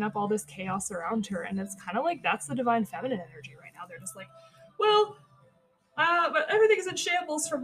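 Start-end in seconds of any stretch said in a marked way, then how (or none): 4.8-7.6 high, loud opening on an exaggerated "Well"; acted exclamation. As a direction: voice someone reacting to chaos, pitched up and loud. Voicing the divine feminine's reaction to chaos.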